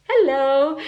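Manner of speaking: normal talking voice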